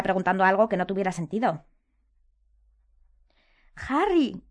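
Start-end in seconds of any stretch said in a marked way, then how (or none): 3.9-4.4 high, falling, fearful call. A narrator's reading. Hermione addresses Harry, her voice betraying fear. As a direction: let fear show on the name. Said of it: "con una voz que delataba su miedo".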